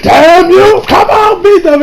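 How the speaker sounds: high, loud cheering shout; frantic Supreme Kai. Retold or imitated. Supreme Kai losing it in the stands, high and loud. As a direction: scream at your fighter from the sidelines. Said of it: "Supreme Kai being like".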